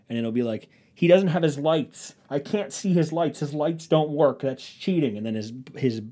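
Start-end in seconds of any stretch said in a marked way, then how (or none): 0.9-5.2 mock whining, higher and drawn out; whiny complaint. Driver complaining over the radio about lights, mock whiny. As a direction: lightly whine like an aggrieved racer. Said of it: "They are the most whiny".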